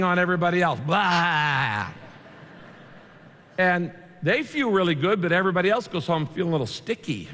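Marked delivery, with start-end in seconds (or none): 0.8-1.9 a disgusted retching blah; mock retch at the crowd; mock vomiting noise. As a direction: spit it out like a spew. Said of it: "spiritually vomiting".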